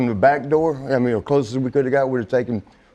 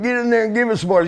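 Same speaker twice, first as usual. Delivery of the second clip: bossy order; 10 semitones higher, faster than usual. Retold or imitated. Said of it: "And the Tom's like".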